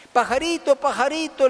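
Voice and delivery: high, repeated call for attention. Acted. Trapped boy calls a passing bird, calling out. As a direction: call out urgently, pitched well up.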